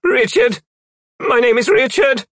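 high, loud frightened cry; panicked captive. A narrator's reading. Captive answering demons, in a panicked high voice.

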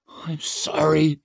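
slow, hushed whisper; choking whisper. A narrator's reading. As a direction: whisper the apology close to her ear. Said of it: "came a whispering, choking voice".

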